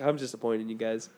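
low, soft imitation of being let down; upset, disappointed. Imitating upset Drew over pooping stories, quietly disappointed.

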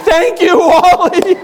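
high, loud laughing thanks; grateful cry. Retold or imitated. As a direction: burst out in relieved gratitude. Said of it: "His name was Wally".

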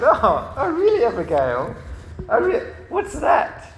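high, loud mock surprise; amused surprise. Dad answering his little girl's claim, playfully surprised. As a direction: play up an intrigued parent's reaction.

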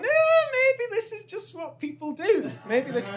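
a high, slow, persuading inner voice; high, slow, coaxing inner voice; tempted inner voice. Retold or imitated. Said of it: "another bit of my brain's going".

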